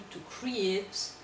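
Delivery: ordinary talk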